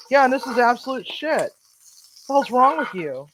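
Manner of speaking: high, loud outraged scolding; angry scolding. Retold or imitated. Chef scolding the cook over the bad sauce, outraged. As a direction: scold him in disbelief.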